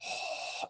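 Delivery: hoarse exhale from the back of the throat; throaty exhale. Shown as a demonstration. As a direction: fog a mirror with your breath. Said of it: "hear it in the back of your throat".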